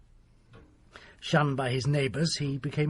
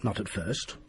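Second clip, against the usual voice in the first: ordinary delivery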